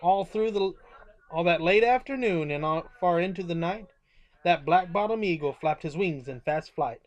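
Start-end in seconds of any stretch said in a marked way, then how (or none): none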